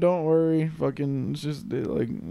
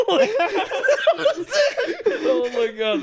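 laughing; much slower than usual. Real, not acted. Cracking up at the song lyric.

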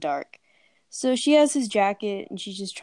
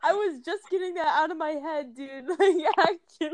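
laughing; 7 semitones higher than usual. Real, not acted. Still laughing after the dreaded title.

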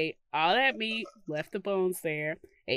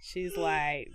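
ordinary talk, nothing marked

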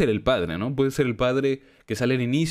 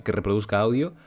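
ordinary talk, nothing marked

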